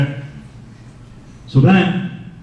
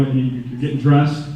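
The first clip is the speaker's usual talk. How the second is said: ordinary delivery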